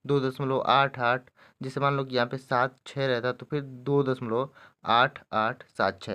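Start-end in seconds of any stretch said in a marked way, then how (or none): 0.0-1.3 slow, separate "आठ आठ"; deliberate demo reading of "आठ आठ" as two digits. Shown as a demonstration. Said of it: "एक एक करके पढ़ते हैं". 3.6-6.1 slow, separated "आठ आठ सात छः"; deliberate demo, each of "आठ आठ सात छः" apart; digit by digit. Shown as a demonstration. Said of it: "ऐसे पढ़ेंगे".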